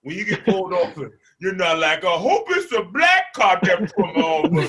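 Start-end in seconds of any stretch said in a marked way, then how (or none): 2.0-4.6 high, loud gushing quote; hammed-up hopeful outburst; mock excitement. Acted hopeful cry, mocking the idea.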